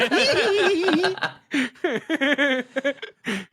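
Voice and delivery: put-on high squealing toddler giggle. Imitating a friend running off like a toddler, high giggle. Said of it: "runs away like a toddler going".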